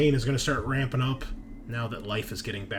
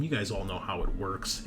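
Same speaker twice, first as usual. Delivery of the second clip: ordinary talk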